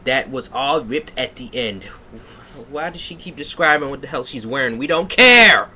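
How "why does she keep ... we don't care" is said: exasperated outburst. Real, not acted. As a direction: rattle off the gripe, then shout the last words. Exasperated at the author's descriptions.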